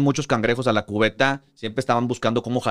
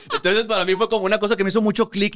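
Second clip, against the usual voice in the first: laughing; 10 semitones higher than usual. Real, not acted. Amused recalling film line.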